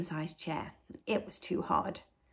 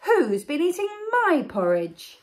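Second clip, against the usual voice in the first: higher, loud mother bear voice; medium-sized Mother Bear; 9 semitones higher, 7 dB louder than usual. Acted. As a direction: ask indignantly as the mother bear, pitched up. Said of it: "a medium-sized voice".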